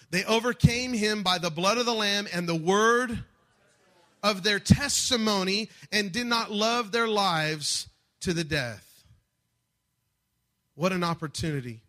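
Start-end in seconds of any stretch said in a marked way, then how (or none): 0.1-9.1 verse declared with more force; stronger. Shown as a demonstration. Pastor asks congregation to say it stronger, firmer. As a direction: say it stronger, lean into it. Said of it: "Let's do it a little bit stronger".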